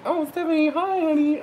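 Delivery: soft, high-pitched affectionate hello; tender, lilting high greeting; sweet greeting. Real, not acted. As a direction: coo it up high to a dear client. Greets a client joining live.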